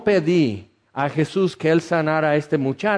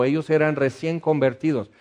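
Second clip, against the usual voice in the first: normal talking voice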